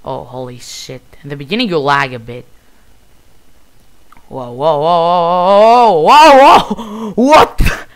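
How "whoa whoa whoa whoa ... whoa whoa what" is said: rising string of loud, high cries; startled yelling. Real, not acted. Player caught off guard by the dragons, startled. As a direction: yell in alarm, climbing to a shocked peak.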